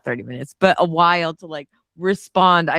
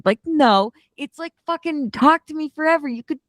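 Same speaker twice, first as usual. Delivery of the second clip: high, worked-up fan insistence; gushing, exasperated objection; emphatic rebuttal; 8 semitones higher than usual. Acted. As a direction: push back hard, pitch leaping up. Gushing rebuttal to imagined hater.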